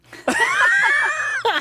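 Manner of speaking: a big shouted laugh bursting out high; real laughter that breaks out loudly. Real, not acted. She laughs at trombone serenade.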